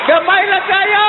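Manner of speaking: high, shouted exclamation; interpreter's high-pitched amazement; incredulous echoing cry. Acted. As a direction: echo the punchline way up high.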